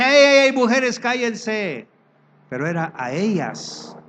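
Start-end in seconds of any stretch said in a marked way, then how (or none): 0.0-1.9 loud, higher shout to silence; mock hush. Paul silencing the noisy women, mock loud shout.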